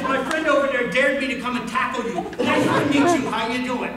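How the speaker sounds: high, loud imitation of an eager kid; boisterous greeting. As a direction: mimic a cheery tackler introducing himself. Acting Luke explaining the tackle.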